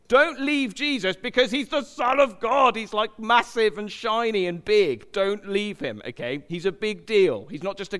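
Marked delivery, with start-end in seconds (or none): 0.1-6.0 loud high urgent plea; fervent pleading. Retold or imitated. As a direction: beg them not to walk away. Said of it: "He's been going".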